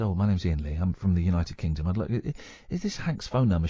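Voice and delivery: low-pitched, posh English phone voice; posh caller introducing himself politely. Retold or imitated. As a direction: put on a polite, posh British voice. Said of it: "I put on a very posh voice".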